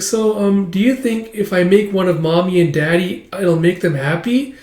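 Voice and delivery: put-on hopeful little girl. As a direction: ask sweetly like an unsure kid.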